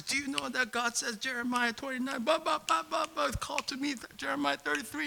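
high-pitched, rattled-off preacher quoting verses; preacher on a roll; reenacted preaching. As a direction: reel off passages in a high, urgent voice. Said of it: "like, preaching".

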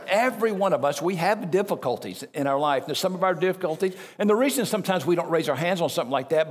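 usual speaking voice

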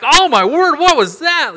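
high, loud startled yelp after the air puff; shocked yelp. Retold or imitated. Retelling the eye-exam air puff, loud shocked yelp. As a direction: cry out, shocked and hurt. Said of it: "and I'm like".

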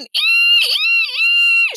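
piercing high "ee" shrieks; toddler's ear-splitting squeal; sustained screech at full volume; very loud screech. A narrator's reading. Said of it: "she screeched as loud as she could".

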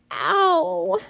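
sharp high yelp of pain. A narrator's reading. Little sister yelping when thumped on the head.

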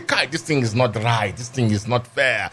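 slow, drawn-out imitated judge complaint; lightly voiced pleading judge; nigerian accent. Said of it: "You cannot say".

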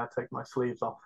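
ordinary talk, nothing marked

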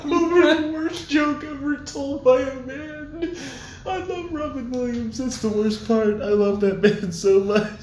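high, loud wail through laughter; laughing to tears. Real, not acted. Comedian who loves the actor he mocked, wailing with laughter. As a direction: lament at full pitch while cracking up.

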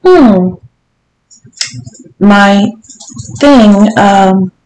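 hesitant, halting embarrassment; stuttering. A narrator's reading. Visitor explaining himself to the lady, stuttering awkwardly. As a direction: falter between words, searching for them. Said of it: "Jim stuttered".

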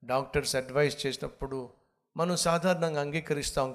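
normal talking voice

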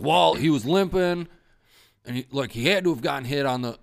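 high, loud mock Rick voice, a bit fast; imitating Rick. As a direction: ham up the buddy's lame excuse. Quoting Rick's excuse.

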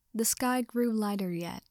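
ordinary delivery, nothing marked

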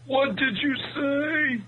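put-on high, slow deaf old man; pretending not to hear. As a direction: ask it like a deaf old-timer. Old men who can't hear.

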